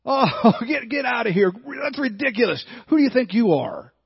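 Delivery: high, loud scoffing laugh through the words; mocking laughter. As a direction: laugh them off as ridiculous. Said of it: "Their cries turned to laughter".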